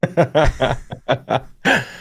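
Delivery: bursting laugh without words; amused belly laugh. Real, not acted. Real laugh at friend's remark.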